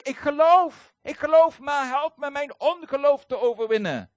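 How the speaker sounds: loud, high desperate cry. Retold or imitated. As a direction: cry out your faith with all your strength. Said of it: "riep onmiddellijk". (de vader roept wanhopig luid uit)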